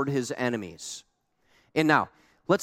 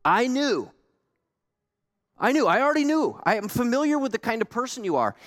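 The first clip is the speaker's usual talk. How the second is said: much higher, exasperated accusation; Jonah complaining to God; 7 semitones higher than usual. Retold or imitated. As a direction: throw it back at God in frustration. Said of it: "He's talking to God now".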